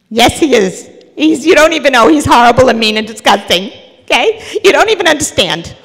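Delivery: put-on loud, high, furious child protest; child yelling back. Child yelling back at the mother, loud and furious. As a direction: shout it like a kid who won't be corrected.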